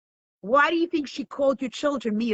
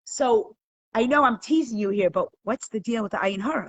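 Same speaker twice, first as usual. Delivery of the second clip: ordinary delivery, nothing marked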